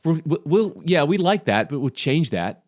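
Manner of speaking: high-pitched, put-on mocking voice; hammed-up caricature of Americans, stammering into it. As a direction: parody Americans in a silly high voice. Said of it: "Classic Americans".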